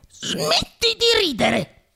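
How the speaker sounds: high, very slow piqued rebuke; irritated. A narrator's reading. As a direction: tell the puppet off, offended. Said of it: "disse Geppetto impermalito".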